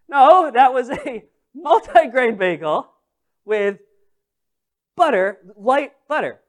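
loud, slowed correction broken by laughs; laughing correction. Retold or imitated. Correcting the cashier again, laughing and loud. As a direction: correct him while laughing in disbelief.

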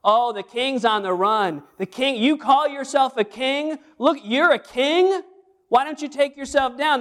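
mock taunt of the crowd; mocking jeer. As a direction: jeer at the king on the cross. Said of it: "what did they do".

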